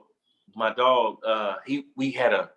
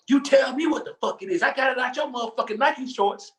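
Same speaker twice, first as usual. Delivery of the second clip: high-pitched mock angry outburst; imitating her going off; 12 semitones higher than usual. Boyfriend imitating his woman confronting him, high mock outrage. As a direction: imitate an irate partner, pitched way up. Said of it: "when I tell you she went off".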